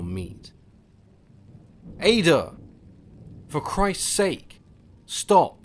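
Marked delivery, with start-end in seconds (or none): 2.0-5.6 high, loud pleading yell; desperate shout. A narrator's reading. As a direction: scream at her to stop. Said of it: "I found my voice".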